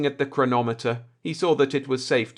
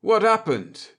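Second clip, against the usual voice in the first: high, pointed question; sharp demand; 10 semitones higher, 4 dB louder than usual. Acted. Navigator demanding an answer from Akers, high and sharp. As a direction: press him hard for an answer. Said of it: "sharp eyes narrowed".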